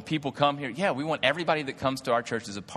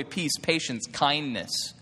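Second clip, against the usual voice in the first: normal talking voice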